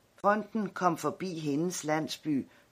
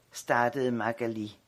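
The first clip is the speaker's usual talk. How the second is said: plain reading voice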